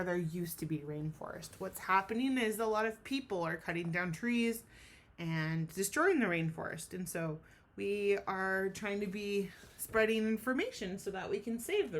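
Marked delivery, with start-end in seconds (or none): none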